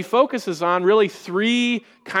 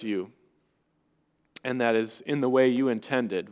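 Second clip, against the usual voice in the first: soft, hushed prayer to the Father; a quiet pastoral prayer; 3 semitones lower, 5 dB quieter than usual. Acted. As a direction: pray it quietly and reverently, letting pauses sit. Leads a prayer after the reading.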